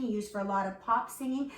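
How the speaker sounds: normal talking voice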